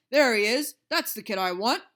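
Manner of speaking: loud excited boss; calling out. A narrator's reading. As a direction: point and call loudly. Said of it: "he pointed and called".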